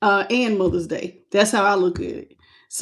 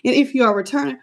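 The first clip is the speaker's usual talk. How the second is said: ordinary talk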